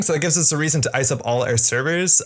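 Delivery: ordinary talk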